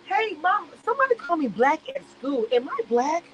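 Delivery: a hint of a clueless kid at home; mock naive kid. Voicing Amira as a kid asking her mom, mild mock innocence.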